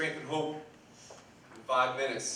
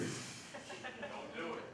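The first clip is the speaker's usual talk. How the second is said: whispered; 3 semitones higher, 9 dB quieter, much slower than usual. Real, not acted. Friend warns him off joke.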